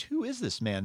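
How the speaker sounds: high, softened question of wonder; amazed. A narrator's reading. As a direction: read it in hushed, high-pitched amazement. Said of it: "the disciples were amazed".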